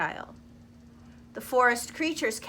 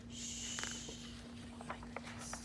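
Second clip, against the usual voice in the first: much softer whisper; hushed whisper; 21 dB quieter than usual. Acted. Hushed forest animals watching the mouse, whisper. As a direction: breathe it in awe.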